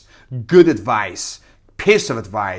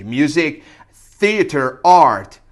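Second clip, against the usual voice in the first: normal talking voice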